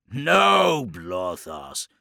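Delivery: commanding warlord. A narrator's reading. Chaos lord correcting his warrior, commanding. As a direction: overrule an underling firmly.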